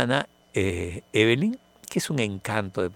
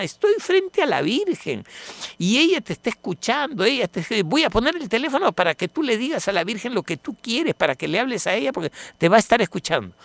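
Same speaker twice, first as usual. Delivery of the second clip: convincing her sister; 9 semitones higher than usual. Retold or imitated. Sister convincing her sister by phone, high and tender. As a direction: plead sweetly, almost childlike. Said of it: "convencer a su hermana".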